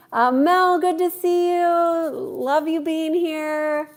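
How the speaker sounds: high, drawn-out affectionate welcome; delighted greeting. Real, not acted. Streamer welcoming Mel to the live, high delighted greeting. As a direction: sing out the hello, stretching the last words.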